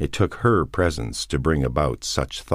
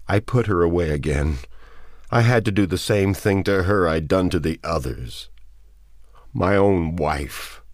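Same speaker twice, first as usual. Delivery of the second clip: raised, anguished confession; trembling voice; 4 semitones higher than usual. A narrator's reading. Man confesses destroying his wife, trembling. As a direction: confess it in grief, close to breaking. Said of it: "in a trembling voice".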